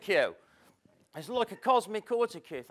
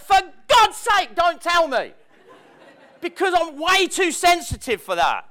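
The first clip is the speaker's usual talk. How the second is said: mock outcry; 6 semitones higher, 6 dB louder than usual. Speaker begs the audience, in a loud mock outcry. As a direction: throw it out like a wounded performer.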